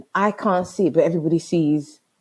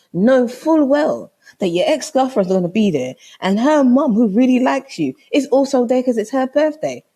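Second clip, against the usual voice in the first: high, emphatic, incredulous tirade; indignant confrontation; 5 semitones higher, 4 dB louder than usual. Retold or imitated. Girlfriend to boyfriend about his ex's mum's barbecue, indignant. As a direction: lay out the offence point by point, pitched up. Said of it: "This is me".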